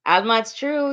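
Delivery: ordinary talk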